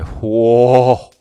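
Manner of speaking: loud, awestruck whoa; excited. Retold or imitated. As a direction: re-enact an excited double take.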